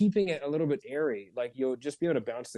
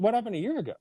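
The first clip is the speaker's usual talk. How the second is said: higher, breezy connecting question; casual upbeat curiosity; light curious; 8 semitones higher, much faster than usual. Shown as a demonstration. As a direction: ask like a mate who's intrigued. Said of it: "connecting situation question voice".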